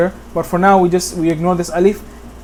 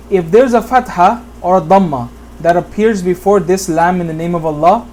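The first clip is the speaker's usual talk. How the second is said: ordinary delivery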